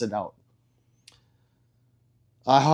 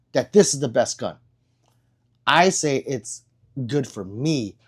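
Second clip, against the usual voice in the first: ordinary talk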